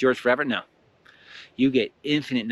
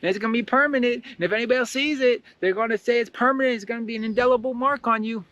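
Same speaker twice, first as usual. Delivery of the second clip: much higher, taunting inner-voice act; mocking negative voice; 12 semitones higher than usual. Negative voices telling you that you're flawed, high mocking voice. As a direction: put on a high-pitched voice of doubt. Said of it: "Those negative voices are gonna tell you".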